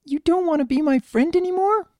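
high, wounded filly voice; hurt child. A narrator's reading. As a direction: ask like a little one close to tears. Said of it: "wearing a hurt expression".

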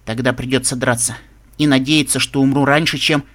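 plain reading voice

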